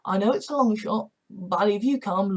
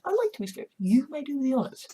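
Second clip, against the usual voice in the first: high playful voice; 6 semitones higher, 3 dB quieter, faster than usual. Acted. Anna flirting playfully.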